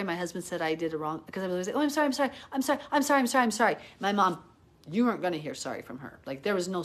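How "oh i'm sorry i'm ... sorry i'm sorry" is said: high, hurried string of apologies; mock yourself apologizing far too much; over-apologizing. Over-sorry mom to her child, exaggerated repeated apology. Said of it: "I'm the mom who over said sorry".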